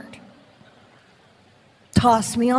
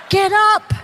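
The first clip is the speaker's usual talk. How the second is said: a loud, higher bark to get up; mock command; 7 semitones higher, 5 dB louder than usual. Husband ordering his wife off the floor, loud and higher. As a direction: snap the order out loud and high.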